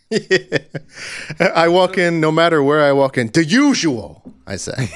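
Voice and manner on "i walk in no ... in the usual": brash New Jersey regular, a bit higher and louder; New Jersey accent. Retold or imitated. Jersey guy walking into his diner, New Jersey accent. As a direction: play it up like a Jersey local at the diner. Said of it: "I like to let them know I'm from New Jersey".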